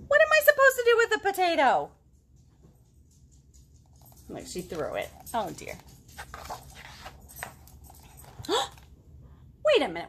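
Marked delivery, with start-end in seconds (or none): 0.1-1.9 much higher, louder, fast complaint; exasperated kid. Acted. Girl in the book complaining about the potato, high and fast. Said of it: "girl scorns potato". 8.2-8.7 sharp high gasp of surprise. Acted. Storyteller gasping as the potato flies. Potato thrown, reader gasps.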